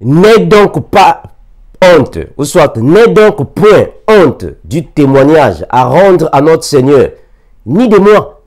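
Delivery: loud, slow, high proclaiming; scripture reading. Acted. Preacher reading Paul's words to the church, slow and loud. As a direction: thunder out the verse word by word. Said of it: "Deux Timothée un huit".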